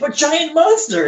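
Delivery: very high, slow pleading Hulk voice; Hulk impression. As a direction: beg like a big dumb monster child. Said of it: "He's like".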